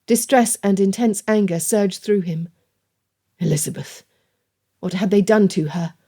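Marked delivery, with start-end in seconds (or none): none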